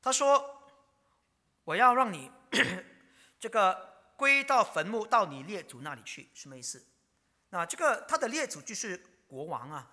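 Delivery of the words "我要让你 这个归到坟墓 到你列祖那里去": solemn voice of God. Retold or imitated. Preacher voicing God promising Josiah peace, loud and solemn. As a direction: declare it as God's promise.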